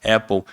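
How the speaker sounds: Apple with an open English A; Apple the proper English way; corrected Apple. Shown as a demonstration. Teacher correcting the student's Apple. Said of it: "Não, Apple".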